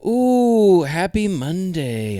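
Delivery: high slow mystic croon; fortune teller. Acted. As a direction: croon it slowly like a seer. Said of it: "A S M R Mystic voice".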